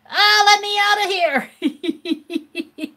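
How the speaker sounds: high, slow, laughing plea to escape; panicked plea. Retold or imitated. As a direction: plead to get out, half laughing. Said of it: "I said".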